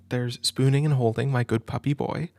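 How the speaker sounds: usual speaking voice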